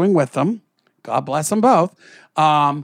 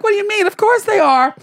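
high-pitched, incredulous comeback; mock indignant protest; 6 dB louder than usual. Grandchild answering grandma on the phone, mock indignant protest. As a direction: protest in a high voice, acting out disbelief.

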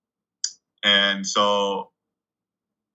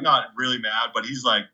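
normal talking voice

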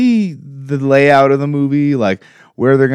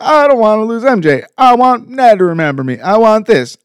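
put-on much higher, pleading kid voice; whiny Peter; 10 semitones higher, 4 dB louder than usual. Peter begging to keep his friends, a high whiny plea. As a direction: plead like a desperate teen. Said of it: "Peter's like".

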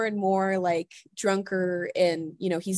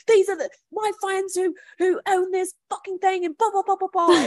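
much higher, hammed-up indignant rant; mock indignant friend; 12 semitones higher, 5 dB louder than usual. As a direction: rant like a buddy puffing himself up. Director friend outraged.

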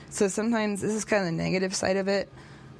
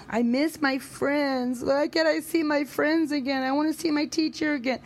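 imitating tearful child; 8 semitones higher than usual. Daughter tells mom she misses her friends, mock tearful and higher. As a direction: lightly play the weepy kid. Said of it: "She's like".

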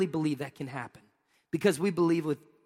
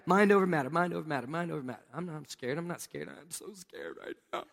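mumbled pep talk fading to a near-silent mutter; self-talk fading; 6 dB quieter than usual. Retold or imitated. Scared student talking himself down, fading into soft fearful mutter. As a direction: psych yourself up then lose your nerve, fading softer. Calming himself before needle.

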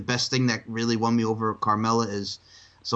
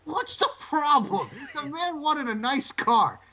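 high, slow, whining mock outrage; high drawn-out complaint defending Rusev; mock exasperated whine; 14 semitones higher, slower than usual. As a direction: whine it out, laughing in disbelief.

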